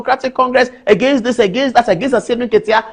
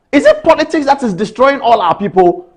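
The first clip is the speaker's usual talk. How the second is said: ordinary talk, nothing marked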